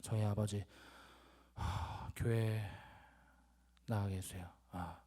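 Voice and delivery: low, slow, halting mumbled prayer with sighs; reluctant half-hearted prayer. Retold or imitated. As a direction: imitate praying as if it's a struggle. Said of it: "뭐라고 했냐면".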